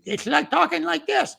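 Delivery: very high-pitched silly character voice; funny giraffe voice. Retold or imitated. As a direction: put on a squeaky, comic cartoon giraffe. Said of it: "gave him a really funny voice".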